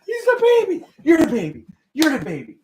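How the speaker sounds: high, laughing pet talk. Real, not acted. Baby talk to dog, laughing.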